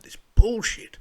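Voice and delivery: loud, high burst of real fury; furious. Real, not acted. Reading the article, he bursts out in fury. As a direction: bite off the words in anger. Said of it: "without getting just furious".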